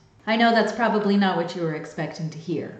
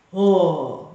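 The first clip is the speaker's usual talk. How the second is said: chest voice demo; 8 dB louder than usual. Shown as a demonstration. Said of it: "chest voice sound".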